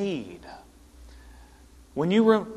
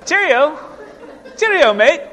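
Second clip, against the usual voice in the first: high, loud mock-English farewell; botched English accent; 11 semitones higher, 9 dB louder than usual. Preacher trying English phrases for laughs, botched English accent. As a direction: ham up an English accent that strays toward New Zealand. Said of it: "that's New Zealand, isn't it?".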